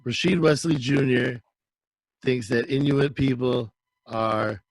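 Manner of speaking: slow, low, deliberate anchor delivery; mock news headline read. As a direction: read it like a solemn mock headline, pausing between phrases.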